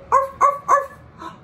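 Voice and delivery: high, loud "arf arf" barks; sea lion barks. Acted. Reader barking like a sea lion for the child.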